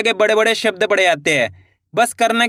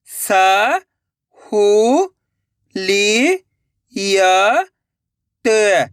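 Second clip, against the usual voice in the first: long drawn-out syllables with pauses between; much slower than usual. Shown as a demonstration. Teacher sounding out a word for learners, syllable by syllable. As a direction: sound out each syllable slowly and separately. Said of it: "एक एक अक्षर का उच्चारण करके".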